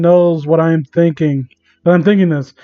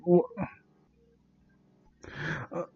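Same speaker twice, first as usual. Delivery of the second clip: normal talking voice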